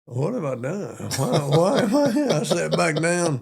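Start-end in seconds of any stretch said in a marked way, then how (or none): 0.1-2.3 mock panicked recruit, rising much higher; a scared young recruit asking himself; bewildered. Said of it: "and I was like".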